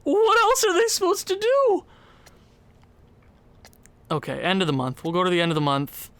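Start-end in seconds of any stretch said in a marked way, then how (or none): 0.0-1.8 exasperated. Real, not acted. Exasperated rhetorical question.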